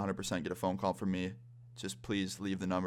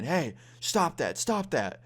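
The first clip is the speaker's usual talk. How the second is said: mock security guard's call, high and drawn out; 6 semitones higher, much slower than usual. Security guard biking up to two painters, mock calling out. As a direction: imitate a guard calling from a distance, pitched up, slow.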